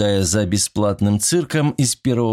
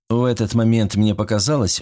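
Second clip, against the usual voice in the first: ordinary delivery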